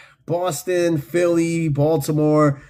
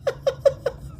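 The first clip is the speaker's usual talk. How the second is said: high-pitched, softer giggling laugh; 5 dB quieter than usual. Real, not acted. Streamer cracking up at chat, high laugh. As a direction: let out a high helpless laugh.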